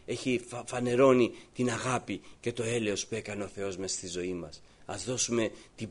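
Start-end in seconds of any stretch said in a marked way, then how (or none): none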